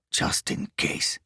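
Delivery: slow unvoiced whisper; low whisper. A narrator's reading. Foreign thief hushes his partner, slow and whispered. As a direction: breathe it slowly under the breath.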